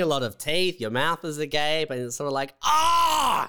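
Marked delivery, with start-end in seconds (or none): 2.6-3.5 sudden high yelp of alarm; mock startled yelp. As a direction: yelp like someone about to attack. Imitating a wide-eyed, agape face.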